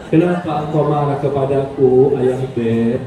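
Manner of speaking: a lightly voiced loving wife; sweet wife. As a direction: soften him with affection. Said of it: "Tapi pakai caranya istri".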